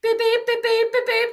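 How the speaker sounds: high, loud, clipped beeps like a watch alarm; imitated watch beep. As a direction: mimic the alarm going off. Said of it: "ten minutes later".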